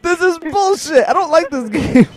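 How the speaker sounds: high, drawn-out whine; high-pitched scared whining; frightened complaint. Real, not acted. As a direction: protest like someone badly spooked. Scared, complaining about the game.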